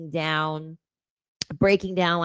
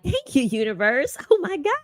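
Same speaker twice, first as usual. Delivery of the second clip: gushing thanks; 11 semitones higher, 6 dB louder than usual. Retold or imitated. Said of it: "And I'm like".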